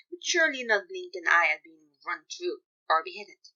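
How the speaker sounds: plain reading voice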